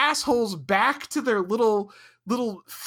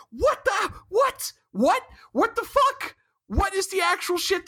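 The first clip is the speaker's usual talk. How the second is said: high, stunned cries of disbelief; what the, what, what, over and over, can't believe it; incredulous what; 12 semitones higher than usual. Real, not acted. Podcaster ranting about Janeway's choice, shrill disbelief.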